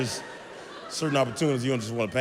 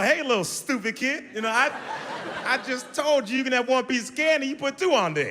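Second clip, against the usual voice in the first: high, mock-angry scolding; scolding the kid; 10 semitones higher than usual. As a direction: tell off the kid, pitched way up. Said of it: "I'm like".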